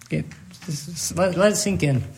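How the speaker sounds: choked up, barely getting the words out; voice breaking. Real, not acted. Pastor choking up mid-story, voice breaking. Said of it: "I'm gonna cry".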